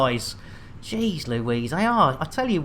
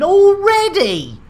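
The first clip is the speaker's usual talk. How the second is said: surprised; 13 semitones higher, 5 dB louder, slower than usual. Real, not acted. Drive broken again.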